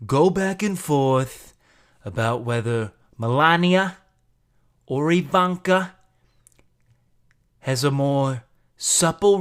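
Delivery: loud, drawn-out Trump voice; slow and boastful like Trump; Trump impression. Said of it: "Can I do Trump?".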